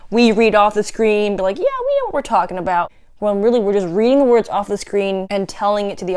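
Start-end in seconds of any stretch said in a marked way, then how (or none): 1.6-2.9 higher-pitched, quick imitation of a know-it-all presenter; mock self-assurance. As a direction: toss it off high and fast, mimicking confidence. Mimics presenters reading off screen.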